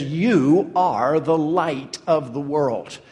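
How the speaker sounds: slow line with a drawn-out stress on "You"; emphatic YOU. Retold or imitated. Jesus addressing his disciples individually, stress on "You". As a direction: lean hard and long on "You", then let the rest follow. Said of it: "that emphatic "you".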